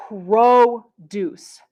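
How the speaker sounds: produce drawn out as PROOO-duce; first-syllable stress. Shown as a demonstration. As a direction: slowly split produce, loud long PRO, then duce. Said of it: "stress the first syllable".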